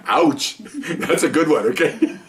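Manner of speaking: chuckling through the words, slowed; amused, laughing at the punchline. Real, not acted. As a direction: laugh out the line as you say it. Laughing at his own story.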